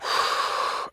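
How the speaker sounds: voiceless whoosh of released breath; demonstrated exhale. Shown as a demonstration. Teacher demonstrating the breath technique, audible exhaling sigh. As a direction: let all the air fall out. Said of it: "I'm just doing that for demonstration".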